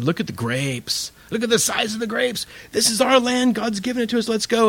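high, eager Joshua rallying the people; excited urging. Retold or imitated. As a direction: sell it like a thrilled scout back from the land. Joshua and Caleb urge Israel.

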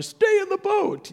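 very high, drawn-out cry; hammed-up high-pitched chant; hollered mantra. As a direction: holler it high as a desperate slogan. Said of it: "my mantra".

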